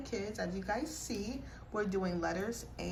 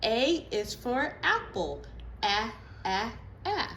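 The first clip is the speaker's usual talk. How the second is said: teaching letter sounds; slower than usual. Shown as a demonstration. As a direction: sound out each letter for kids. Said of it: "now we do the sounds".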